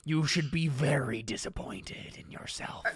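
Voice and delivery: slow, solemn, ominous sentencing; menacing old man. Acted. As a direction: pronounce a disgrace with grave, stretched words. Ernest pronounces judgement on Paul.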